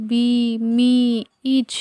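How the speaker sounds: "বি", "মি", "ইচ" said singly with a long "ee"; "বি, মি, ইচ" as spaced teaching words with "ee". Shown as a demonstration. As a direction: model the drawn "ee" in "বি", "মি", "ইচ". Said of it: "ই এর উচ্চারণ যখন দীর্ঘইকার হিসেবে হয়".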